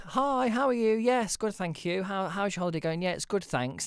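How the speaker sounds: high, chirpy rehearsed small talk; social script voice. Retold or imitated. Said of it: "gather your social script".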